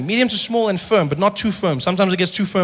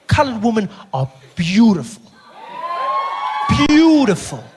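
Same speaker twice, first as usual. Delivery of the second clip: big declaration shouted out; mock grand shout; shouted proclamation; 8 semitones higher than usual. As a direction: announce it to the whole room.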